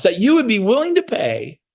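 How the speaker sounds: high-pitched exaggerated spelling-out; mock explaining. Coach teasing agents at an open house, mock explaining. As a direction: lift the pitch and overdo it. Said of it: "I talk to 'em like this".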